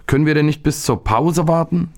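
put-on high, whiny boy pleading; plaintive. A narrator's reading. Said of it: "fragte der Junge wehleidig". (wehleidig bettelnd, mit hoher Jungenstimme)

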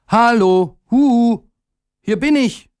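high, eager little-character call; high-pitched excited hello. A narrator's reading. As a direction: call out brightly to a crowd far below. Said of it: "rief er".

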